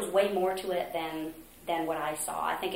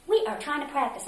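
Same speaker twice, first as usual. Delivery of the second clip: higher, louder irritated complaint; annoyed director; 8 semitones higher, 4 dB louder than usual. Retold or imitated. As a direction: mimic an exasperated scold. Retold annoyed choir director.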